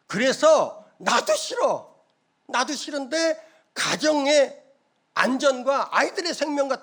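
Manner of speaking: high, loud tearful pleading; tearful plea. Retold or imitated. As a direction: cry it out as the wife begging her husband. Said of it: "그때 부인도 막 펑펑 울어요".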